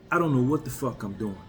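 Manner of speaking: ordinary talk, nothing marked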